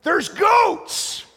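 high, loud call to someone far off; hollering. Retold or imitated. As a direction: holler the news down the trail. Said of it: "we just holler to".

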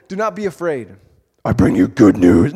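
drops very low and booming on "I bring you good news"; overdone angel voice. Pretend. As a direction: ham up a grand angel announcement. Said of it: "too, too much".

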